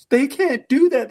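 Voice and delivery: high, loud tearful wail, acted out; slow, high-pitched mock distress; mock crying wives. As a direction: imitate wives wailing in protest. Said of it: "wives in the room started crying".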